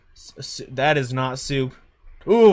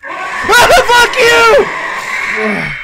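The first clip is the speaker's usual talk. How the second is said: screaming; 14 dB louder, much slower than usual. Real, not acted. Jump scare, screaming at monster.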